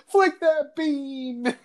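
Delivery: high, slow, drawn-out creep voice; mock pervy line. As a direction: stretch it out like a sleazy whisper. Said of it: "he went from".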